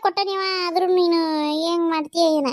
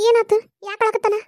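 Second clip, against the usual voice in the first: high, urgent questions; concerned questioning; 6 semitones higher, 3 dB louder than usual. Acted. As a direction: call out in concern, pitched up high. Asking why the baby cries. (ಗಾಬರಿಯಿಂದ ಏರುದನಿಯ ಪ್ರಶ್ನೆ)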